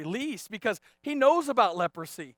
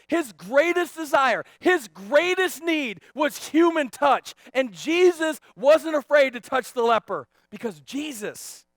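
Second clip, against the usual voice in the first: loud, raised preacher's delivery; full-force preaching; 7 semitones higher, 11 dB louder than usual. Real, not acted. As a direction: preach it out, higher and louder.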